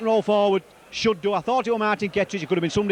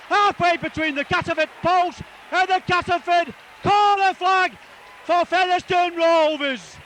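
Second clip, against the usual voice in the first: excited try call; 9 semitones higher than usual. Real, not acted. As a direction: ride the runner all the way to the line. Live call of a length-of-field try.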